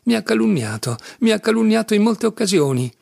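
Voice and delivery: high-pitched, aggrieved indignation; quivering with offence. A narrator's reading. Ivan telling his brother he was slandered, indignant. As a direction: repeat the grievance, stung and heated. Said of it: "disse Ivan fremendo per l'offesa".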